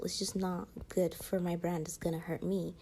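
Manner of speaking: ordinary talk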